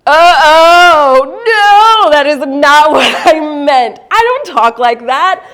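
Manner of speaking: loud, high, slow horrified protest; panicked denial. Retold or imitated. Realizing what she said to Miguel, in loud panic. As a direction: backpedal in mortified panic. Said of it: "And I went".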